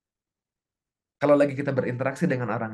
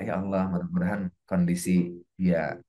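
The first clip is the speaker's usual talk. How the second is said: low, subdued supplication; low murmured blessing; heartfelt prayer; 5 semitones lower than usual. Shown as a demonstration. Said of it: "iringi dengan doa-doa kita untuk dia".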